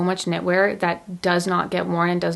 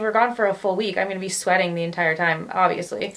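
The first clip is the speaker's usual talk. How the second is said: normal talking voice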